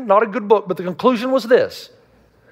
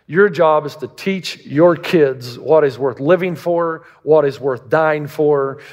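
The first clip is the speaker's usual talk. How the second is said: slow emphasis; 4 semitones lower, slower than usual. Real, not acted. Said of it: "preached emphasis".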